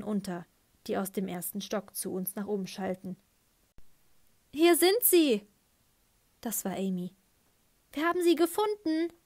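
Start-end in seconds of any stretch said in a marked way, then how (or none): 4.5-5.5 loud excited shout; calling out. Acted. Girl calls out to the searchers, loud and excited. Girls calling out at finding them. 7.9-9.1 high, excited shout; excited call. Acted. Gracie calls to everyone, high and excited. Girl calling out the find.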